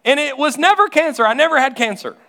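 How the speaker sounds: high, loud joyful cries; high, loud mock rejoicing of the relieved friend; overjoyed praise. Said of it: "I got a call from her".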